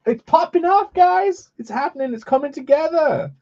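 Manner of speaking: high excited streamer voice; thrilled it's coming together. Real, not acted.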